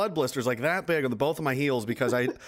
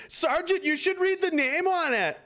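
high, slow mock-innocent tattle; smug tattling; slower than usual. Soldier mimicking a comrade ratting him out, high and slow. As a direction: drag it out high like a smug snitch. Retelling Donnie's smug remark.

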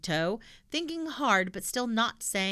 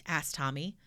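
plain reading voice

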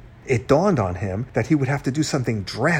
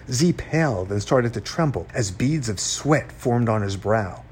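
plain reading voice

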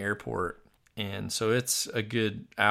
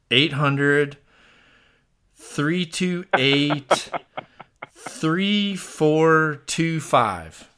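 slow dictation; 5 semitones higher, 5 dB louder, much slower than usual. Real, not acted. Reading digits off the keypad.

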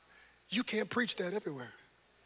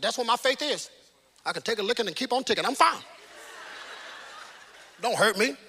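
mock tough believer; 4 semitones higher, 8 dB louder than usual. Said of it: "we just tough it out".

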